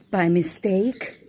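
ordinary talk

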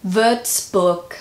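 Würzburg with ü and an ending like "book"; German-style pronunciation. Shown as a demonstration. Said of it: "I'm gonna try it again".

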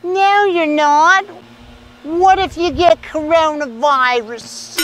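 high, drawn-out imitation of a wife. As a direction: stretch it out high, like a disapproving wife. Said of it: "and then his wife says".

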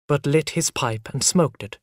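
usual speaking voice

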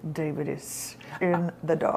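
low, very quiet seething; mean it darkly under your breath; quiet, deep mock menace; suppressed rage. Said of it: "It was rage".

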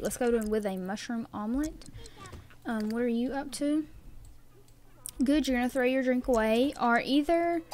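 normal talking voice

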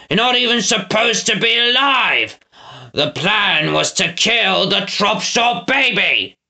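high, drawn-out angry retort; snapping villain. Acted. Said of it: "Snatcher snapped".

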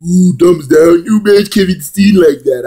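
high, slow, stretched goofy voice; mocking put-on voice. Jeering at Kevin Owens, goofy high mockery. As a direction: drag it out in a silly high voice. Acted mockery after Homer Simpson comparison.

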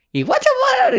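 very high squeaky gibberish voice; mock Korean babble. Narrator imitates the Korean mother's reply in a very high squeal. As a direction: parrot foreign chatter in a tiny squeal.

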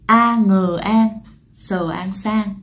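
slow, syllable by syllable Vietnamese spelling "A ngờ an, sờ an sang"; đánh vần chậm rãi. Shown as a demonstration. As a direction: spell "sang" out slowly, sound by sound. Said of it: "thì chúng ta sẽ đọc là gì ạ?".

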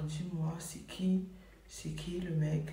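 hushed whisper, slow and secretive; whispered question. Retold or imitated. Whispering to her twin sister who the guy is. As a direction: whisper it so the boyfriend can't hear. Pressing sister about the father.

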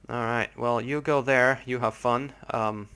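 normal talking voice

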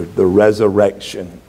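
normal talking voice

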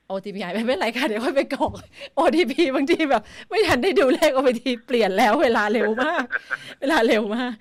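amused, flustered protest through real laughter; laughing complaint. Real, not acted. Host misses the OTP timeout in a live app demo, laughing.

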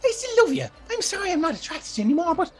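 put-on high, pleading girl, near tears with a laugh; begging Gribble to believe her; high, desperate woman's voice; frantic young woman.

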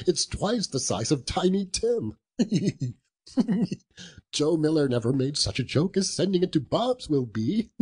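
giggling between phrases, pitch climbing; tickled by your own secret joke; gleeful chuckling. Acted. Scrooge plans a surprise for Bob Cratchit, chuckling gleefully.

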